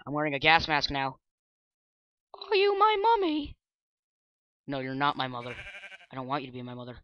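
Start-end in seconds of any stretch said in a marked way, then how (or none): 2.5-3.6 put-on high, eerie little child asking; creepy child voice. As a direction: whisper it like a lost ghost child. Gas mask, Doctor Who child quote.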